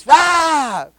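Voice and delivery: sudden loud, high-pitched scream; possessed scream. Retold or imitated. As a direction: cry out like someone possessed. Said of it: "empieza a pegar gritos el endemoniado". (grito de endemoniado)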